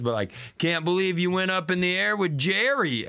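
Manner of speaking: ordinary delivery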